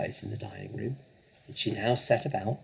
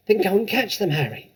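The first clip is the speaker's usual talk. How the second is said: urgent command; 9 semitones higher, 6 dB louder than usual. Acted. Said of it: "hissed the mother".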